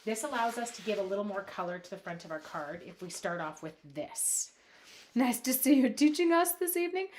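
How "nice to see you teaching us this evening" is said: high, loud mock Aussie accent; attempted Aussie accent. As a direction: put on a playful, higher Aussie accent. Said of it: "Can I try an Aussie one?".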